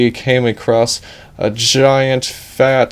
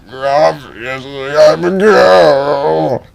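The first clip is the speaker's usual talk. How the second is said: higher, slow, drawn out; offended fairy; 6 semitones higher, 3 dB louder, much slower than usual. Acted. Fairy answering Edge's rude question, slow indignant voice. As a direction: stretch it out indignantly.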